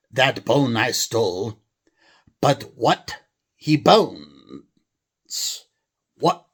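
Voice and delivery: mock English accent troll line. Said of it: "a normal English accent".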